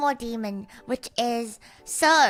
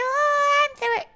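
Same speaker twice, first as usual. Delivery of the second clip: high drawn-out retort; 5 dB louder, much slower than usual. Pretend.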